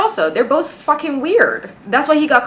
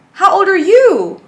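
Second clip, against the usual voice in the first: incredulous; 9 semitones higher, 6 dB louder than usual. Real, not acted. Reactor doubting the date's age.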